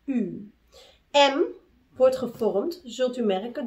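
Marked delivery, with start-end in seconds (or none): 0.0-0.5 U said through pursed lips, lower and falling; rounded-lip U. Shown as a demonstration. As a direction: pout the lips to sound U. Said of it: "moeten de lippen worden getuit".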